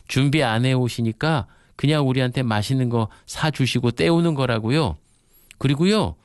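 put-on reply like children blurting out a complaint; imitated children. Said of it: "아이들이 대뜸 이렇게 말합니다".

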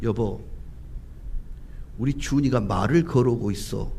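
low, soft, unhurried husband voice; seasoned calm dad. Acted. Experienced dad tells wife their crying baby is talking, calmly. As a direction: speak low and composed, as a knowing father. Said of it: "경험이 있는 저 같은 노련한 아빠는".

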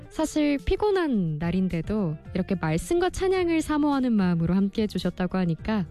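ordinary talk, nothing marked

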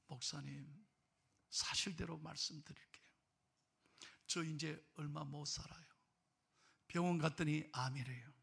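put-on old man softly telling the pastor he has cancer, grave; quiet confession. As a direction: confide it softly, like a dying man. Dying grandfather confessing to pastor.

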